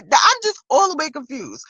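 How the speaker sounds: ordinary talk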